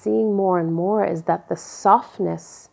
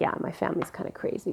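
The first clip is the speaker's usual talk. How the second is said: mock whisper; 9 dB quieter, much faster than usual. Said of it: "I was like".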